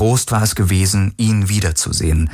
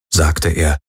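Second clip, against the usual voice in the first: ordinary delivery, nothing marked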